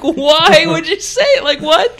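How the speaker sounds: high baffled disbelief; incredulous. Retold or imitated. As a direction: squeak out your confusion, half laughing. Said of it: "I was just like".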